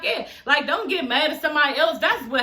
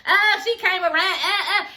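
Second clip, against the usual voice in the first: mocking Kelly; 6 semitones higher than usual. Reviewer mocking Kelly's cheer over Aubrey, high and giggly. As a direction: ham up Kelly's giddy cheer. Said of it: "And then Kelly gonna say".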